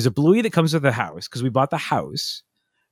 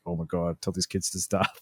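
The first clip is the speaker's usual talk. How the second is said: muttered complaint; 5 semitones lower, 9 dB quieter than usual. Retold or imitated. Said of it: "everybody was like".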